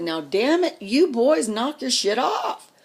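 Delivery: retold loud, high scolding; mock yell to break up a fight.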